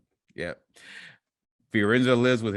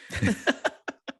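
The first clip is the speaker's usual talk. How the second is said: high breathless laugh; 12 semitones higher than usual. Real, not acted. Laughing at cat-petting burglar.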